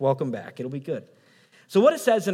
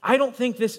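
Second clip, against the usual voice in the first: normal talking voice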